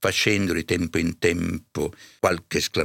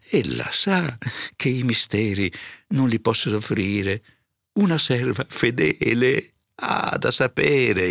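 higher, put-on tearful servant; 6 semitones higher than usual. A narrator's reading. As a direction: plead as if about to cry. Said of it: "fece voce di piangere". (voce di pianto, lamentosa)

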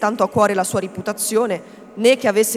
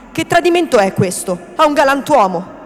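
louder, higher outcry of protest; raised voice, loud and high; indignant cry; 5 semitones higher, 7 dB louder than usual. A narrator's reading. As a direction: cry out against a betrayal.

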